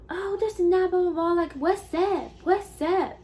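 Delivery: high-pitched confrontational imitation; mock yelling. Customer challenging the worker to fight, high mock yelling. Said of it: "she was just yelling at me".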